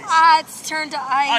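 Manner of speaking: loud cold-shock cry; gasping from the cold. Real, not acted. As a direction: yelp at the freezing water. In freezing water.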